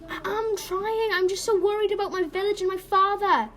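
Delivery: high, worried protest; near tears. Acted. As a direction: plead anxiously, tears on the way. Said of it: "Elizabeth started to cry".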